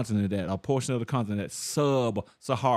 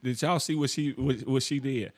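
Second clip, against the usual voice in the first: ordinary delivery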